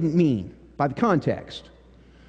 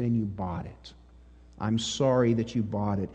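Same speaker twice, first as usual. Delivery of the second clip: much lower and softer, sorrowful; hushed, earnest reproach; 10 semitones lower, 8 dB quieter than usual. Retold or imitated. Youth minister to a girl who was used, hushed and grave. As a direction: drop low and quiet, sincerely sorry.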